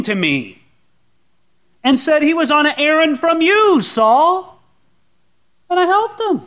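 high, pleading, incredulous priest; can't believe what he just heard, begging why; stunned disbelief. Acted. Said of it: "he couldn't believe his ears".

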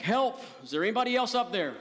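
high, loud comic cry for rescue; mock yell for help. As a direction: call out desperately as the stranded man in a joke. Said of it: "Finally, Jack yelled back".

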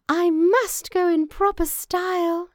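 high, loud, thrilled mouse voice; excited little mouse. A narrator's reading. Mouse prepares to meet the father, thrilled. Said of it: "The little mouse was greatly excited".

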